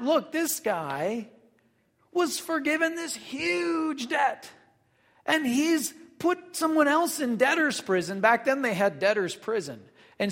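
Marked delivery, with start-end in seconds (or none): 0.0-8.2 shocked servants. Retold or imitated. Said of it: "So they found out".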